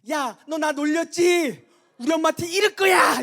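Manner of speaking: high, loud, drawn-out tattletale shout; child's yelled threat. Retold or imitated. Second-grader threatening to tell Mom on the pastor, yelling. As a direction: yell like an indignant schoolkid. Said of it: "이렇게 외치는 거예요".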